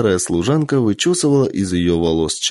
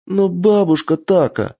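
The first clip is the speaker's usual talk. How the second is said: put-on pleading little girl; 9 semitones higher than usual. Child protests.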